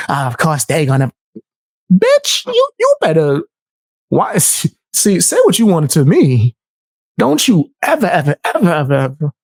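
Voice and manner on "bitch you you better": squeaky scold. Acted. Scolding the caller.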